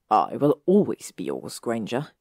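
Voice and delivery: low, rasping vow; rasped. Acted. Draco holding Hermione close, low rasping vow. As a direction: growl the promise close to her ear. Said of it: "pulled her against him and rasped".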